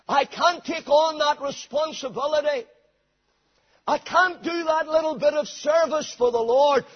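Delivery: high, insistent mock excuses; excuse-making. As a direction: mimic someone pleading they can't. Mimics Christians making excuses.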